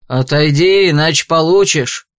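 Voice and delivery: low, firm warning; stern hail. A narrator's reading. Carter ordering a beggar aside, stern warning. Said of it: "Громко окликнул он мужчину".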